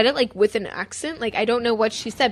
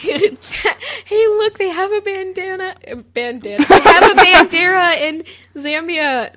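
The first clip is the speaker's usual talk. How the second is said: high-pitched, hammed-up excited quote; playful mock excitement, voice lifted high; excited mock exclamation; 7 semitones higher, 6 dB louder, slower than usual. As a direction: act out pointing out a find, pitched up. Acting out a remembered excited remark.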